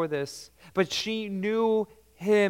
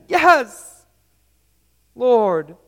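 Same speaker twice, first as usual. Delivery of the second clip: higher, louder earnest cry of yes; humble yes; 7 semitones higher, 11 dB louder than usual. Retold or imitated. Woman answering Jesus, a loud earnest yes. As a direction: answer the Lord with eager surrender. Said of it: "instead she says".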